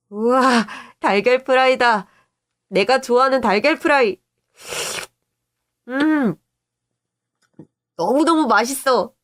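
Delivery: put-on high, excited little-kid voice; delighted child. Boy thrilled by his favorite fried egg, delighted.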